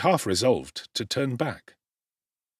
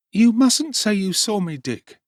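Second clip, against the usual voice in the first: put-on young Oliver; 12 semitones higher, 8 dB louder than usual. A narrator's reading. Oliver asking Dick at dawn, high anxious boy. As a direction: ask anxiously as a runaway boy.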